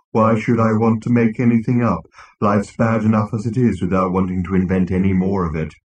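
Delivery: droning, gloomy robot; depressed robot. Acted. Marvin complaining about life to Zaphod, depressed. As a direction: lament it in a dreary monotone.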